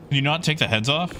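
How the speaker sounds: ordinary talk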